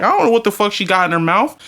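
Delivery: normal talking voice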